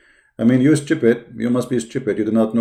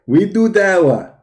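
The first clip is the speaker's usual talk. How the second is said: mock Dawah caller; 8 semitones higher, 3 dB louder than usual. Streamer playing the Dawah team introducing itself, hammed-up higher voice. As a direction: puff up like a self-important team.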